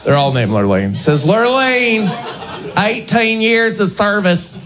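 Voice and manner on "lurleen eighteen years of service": high, drawn-out mock read-out; mock badge reading. Comic reads out a name badge.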